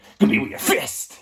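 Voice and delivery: high, pushed, half-shouted order; strained mock yell. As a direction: punch the words out like a tough coach. Sensei lecturing student, voice strains.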